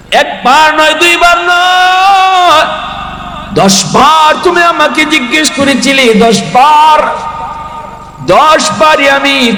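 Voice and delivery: high, drawn-out preacher's chant; fiery sermon. Acted. Preacher reminding sons of a father's patience, soaring chant. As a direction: build to a ringing crescendo.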